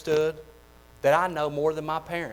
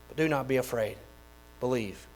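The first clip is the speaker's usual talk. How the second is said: hushed plea; 4 semitones lower, 7 dB quieter than usual. Acted. Soft close after loud plea.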